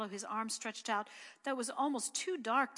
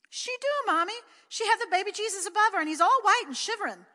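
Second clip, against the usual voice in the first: put-on high, loud pleading child; a small boy insisting his mother believe him; high, loud child's insistence; insistent little boy; 10 semitones higher, 8 dB louder than usual. A narrator's reading.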